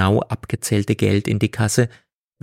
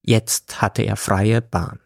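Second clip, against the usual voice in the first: ordinary delivery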